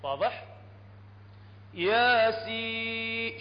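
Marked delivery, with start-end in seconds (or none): 1.7-3.4 ياسين with the ياء held long, very slow; stretched letter names. Shown as a demonstration. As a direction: draw out ياسين, holding the ياء for six counts. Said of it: "شفتوا الياء؟". (ياسين بمدّ طويل للياء)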